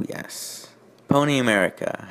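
normal talking voice

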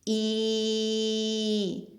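long, held, sung-like Ukrainian vowel І; drawn-out vowel I. Shown as a demonstration. Teacher shows children the vowel, drawn out. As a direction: stretch the sound І out steadily. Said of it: "Давайте разом вимовимо звук І".

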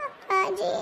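small tearful call. Acted. Child calls to father, tearful. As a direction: call out weakly through tears. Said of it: "ಯಾಕ್ ಕಣ್ಣೀರ್ ಹಾಕಾಕತ್ತಿದಿ".

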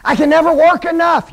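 high, loud mock lament; imitating people who fret over their works; complaining churchgoer. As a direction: whine it like a weary believer. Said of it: "People are always saying".

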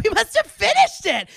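feigned innocence. Retold or imitated. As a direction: act out innocent surprise, a little high. Acting out her feigned innocence.

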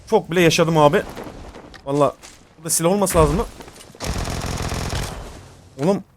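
normal talking voice

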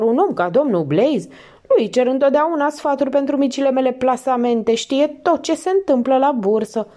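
protest, offended and nervous; indignant landlady. A narrator's reading.

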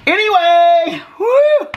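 loud, very high cheer; excited whoop. Acted. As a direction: whoop it out after dancing. Cheering after mock river dance.

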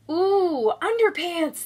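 delighted aliens. A narrator's reading. Aliens spotting a clothesline of underpants, delighted excited cooing. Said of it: "they chant and dance around delighted".

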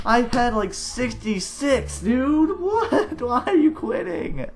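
high, loud, incredulous protest with laughing; dismayed outburst. Real, not acted. Gamer losing a big score to a quitter, dismayed. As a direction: wail at the quitter in disbelief. Upset that opponent quits mid-game.

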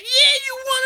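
very high, squeaky mock voice; mocking the staff. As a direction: parody the hype clerk. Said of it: "They're like".

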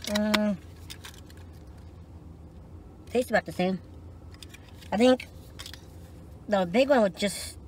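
talking around lip pouch. Real, not acted. Seen 0: Hand places pouch in lower lip at -5; lip stays pushed out.

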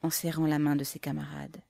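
plain reading voice

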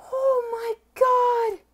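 high, loud overwhelmed gasp and cry; stunned, voice breaking on oh my God; gasp of shock. Real, not acted. Unboxing a coin with a light.

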